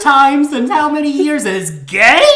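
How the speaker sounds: loud, high, hammed-up disbelief; incredulous outrage. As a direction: belt it out as the mock-scandalized wife. Playing the outraged, disbelieving wife.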